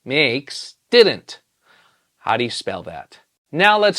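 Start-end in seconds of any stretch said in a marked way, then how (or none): 0.0-1.4 slow, deliberate. Shown as a demonstration. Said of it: "this is a compound word".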